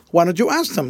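ordinary delivery, nothing marked

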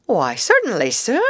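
eager-to-serve, respectful answer; polite little field mouse; respectful reply. A narrator's reading. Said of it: "replied the Field Mouse respectfully".

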